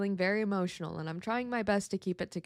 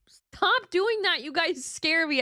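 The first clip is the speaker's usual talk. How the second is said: startled; 6 semitones higher, 4 dB louder than usual. Real, not acted. Chat sound startles her.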